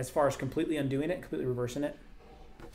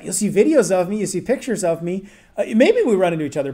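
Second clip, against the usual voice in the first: much louder and higher, playfully excited; excited outburst; 6 semitones higher, 14 dB louder than usual. Real, not acted. Telling a viewer she may spot him, excitedly loud and high. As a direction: burst out with it, a grinning joke. Joking about meeting him at clinic.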